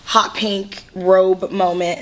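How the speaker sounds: usual speaking voice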